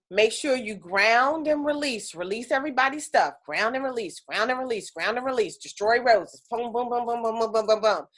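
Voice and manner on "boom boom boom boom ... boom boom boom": usual speaking voice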